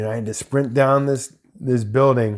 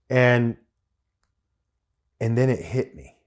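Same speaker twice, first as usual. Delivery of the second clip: normal talking voice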